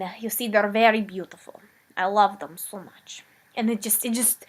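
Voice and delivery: ordinary talk, nothing marked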